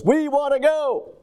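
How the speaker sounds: high, insistent mimicked "We want to go"; emphatic. Preacher imitates pleading to eat out, with emphasis. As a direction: lift "We want to go" high like a plea. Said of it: "they'll put a little emphasis in their voice".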